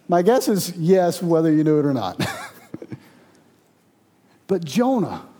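ordinary talk

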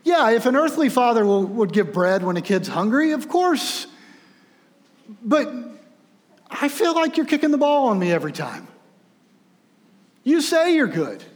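arguing that God keeps kicking the ball away; frustrated doubter. Retold or imitated. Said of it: "you think".

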